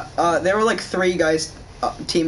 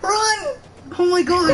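high panicked yell; 12 semitones higher than usual. Real, not acted. Yelling during attack.

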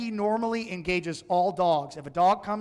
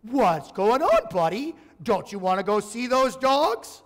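higher, louder mocking tease; 4 semitones higher, 5 dB louder than usual. Owner mocking her nervous dog near the Dobermans, teasingly. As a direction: tease the scared little dog. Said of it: "And I mocked him".

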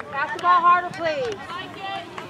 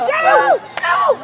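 shouting; 8 semitones higher, 4 dB louder than usual. Real, not acted. Coach yelling at youth soccer.

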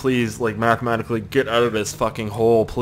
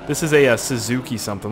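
ordinary talk, nothing marked

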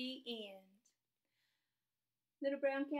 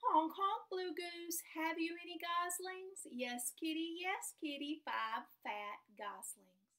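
slow, sing-song storybook read; reading to children; 4 semitones higher, slower than usual. Acted. Teacher reads a rhyming story to her class, sing-song. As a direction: read it out slowly, like a picture book at snack time.

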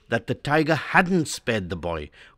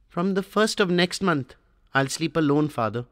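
weak mumble; 5 semitones higher than usual. A narrator's reading. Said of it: "He mumbled weakly".

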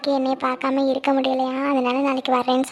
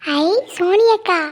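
put-on excited child; 7 semitones higher than usual. Little cousin calls brother excitedly.

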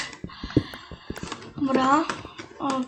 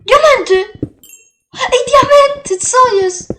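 loud, high cry of discovery; real surprise, shouted high and loud; excited shout; 10 semitones higher, 11 dB louder than usual. Real, not acted. Suddenly finds diamonds in game. (podekscytowany okrzyk)

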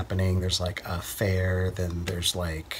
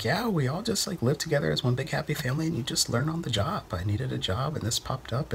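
higher, lightly voiced circus girl, breezy; imitating the girls; 6 semitones higher than usual. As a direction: chirp it like the carefree helper girls. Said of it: "They're like".

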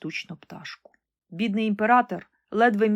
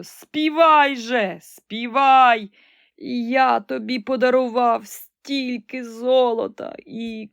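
higher, louder, slow begging; desperate pleading; 4 semitones higher, 5 dB louder, slower than usual. Acted. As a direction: emperor begging the silent golden bird to sing. Emperor begs the bird. (благальний крик)

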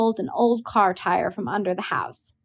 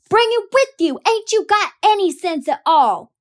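high, loud, exasperated yell; angry holler; 12 semitones higher, 7 dB louder than usual. A narrator's reading. Brother hollering at his sister for leaving the tire, high and loud. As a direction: scold her at full volume. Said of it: "Jem hollered".

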